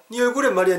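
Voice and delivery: high-pitched dialect insistence; insistent friend. Acted. Friend pointing out the bruise, high and insistent.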